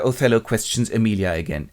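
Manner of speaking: ordinary delivery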